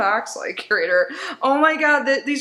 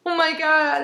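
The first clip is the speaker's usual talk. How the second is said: ordinary talk, nothing marked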